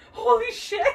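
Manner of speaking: high startled exclamation into laughter; shocked laughing shout. Real, not acted. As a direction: blurt it out in shock, then laugh. Sudden shock mid-sentence.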